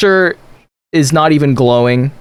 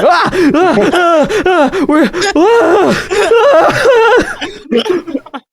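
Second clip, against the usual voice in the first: terrified yelps; 10 semitones higher than usual. Acted. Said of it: "He's like".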